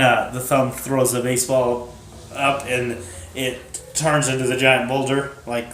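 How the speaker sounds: ordinary talk, nothing marked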